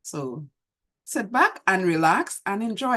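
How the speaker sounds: usual speaking voice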